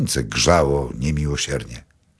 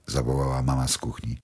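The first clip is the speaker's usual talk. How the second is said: ordinary delivery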